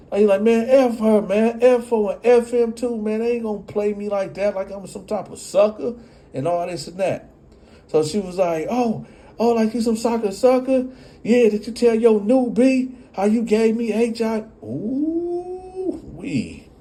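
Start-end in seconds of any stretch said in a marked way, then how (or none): 0.4-7.3 high, fast angry comeback; mock angry father; heated retort. Angry rant. 8.7-14.4 mock furious mother; mocking angry retort. She blurts out. 14.6-16.7 reaction whoop. Real, not acted. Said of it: "That's right".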